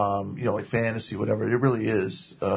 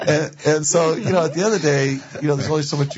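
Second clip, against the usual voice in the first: talking through leftover laughter, higher and a bit faster; laughing; 5 semitones higher, 4 dB louder, faster than usual. Real, not acted. Guest picks up her point while still laughing after the tease, laughing.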